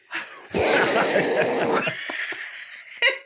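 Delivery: real laugh, high and breathless; high helpless laughter. Real, not acted. Friend laughing at the scene, high real laughter. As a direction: crack up at the picture.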